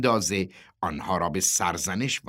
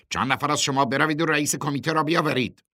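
quick, higher-pitched order; brisk command; 8 semitones higher, 4 dB louder, faster than usual. A narrator's reading. Truck boss sends workers to fetch the chairman, commanding. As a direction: send the men off with authority.

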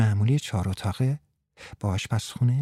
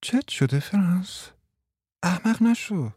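higher teasing woman's voice; teasing Martha; 7 semitones higher than usual. Acted. Martha teasing Frans, higher and playful. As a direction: tease him lightly from above.

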